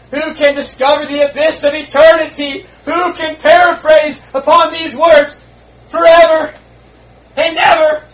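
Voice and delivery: loud, high fervent preaching of a lament; preaching at full force. Acted. Preacher voicing a dying sinner's despair, loud and high. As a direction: cry it out to the congregation.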